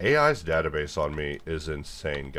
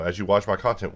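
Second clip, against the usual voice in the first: normal talking voice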